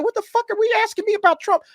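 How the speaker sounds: high, incredulous squeak of disbelief; mock Tom Cruise. Imitating Tom Cruise asked about tariffs, squeaky and baffled. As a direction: play the baffled star caught off guard. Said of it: "And he's like".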